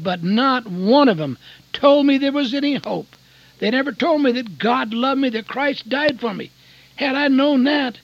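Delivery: loud, rising fervent preaching; a preacher's impassioned cry; full-force preaching. Acted. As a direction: preach it out hard to the congregation.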